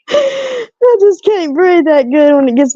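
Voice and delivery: breathless laugh through words. Real, not acted. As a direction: laugh while short of breath.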